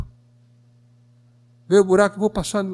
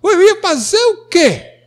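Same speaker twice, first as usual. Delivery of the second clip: higher and louder, climbing to near shouting; the mother defending herself, her voice rising more and more; a defensive mother, pitched up and pleading; voicing the indignant mother; 9 semitones higher, 5 dB louder than usual. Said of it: "Ela disse".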